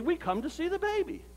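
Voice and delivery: high-pitched, matter-of-fact answer; simple announcement. Acted. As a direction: state it as the obvious reason for visiting. Visitors answer the narrator's question.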